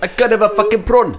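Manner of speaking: mock South African accent. Riffing on the braai joke in a mock South African accent. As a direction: moan about prawns in a put-on South African accent. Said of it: "All the accents".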